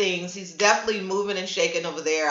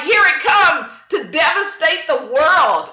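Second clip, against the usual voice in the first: mock dramatic shout; 6 semitones higher, 5 dB louder than usual. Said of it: "It's like".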